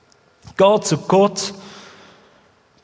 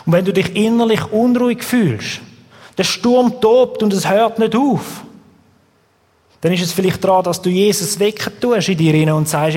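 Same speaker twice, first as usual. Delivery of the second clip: ordinary talk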